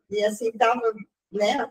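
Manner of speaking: ordinary talk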